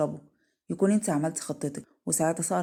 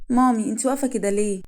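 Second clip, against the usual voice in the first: put-on higher, louder little-kid question; child's voice; 4 semitones higher, 7 dB louder than usual. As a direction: call up to mum like a curious child. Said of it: "وقرب منها يامن". (صوت طفل بيسأل مامته)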